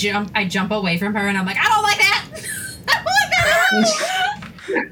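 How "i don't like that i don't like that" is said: laughing yelp. Acted. Jumping away, laughing.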